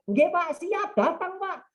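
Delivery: high, slow, eager yes-sir; eager reply. Retold or imitated. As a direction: promise the father-in-law keenly. Groom answering father-in-law eagerly. (calon menantu menjawab mertua dengan semangat)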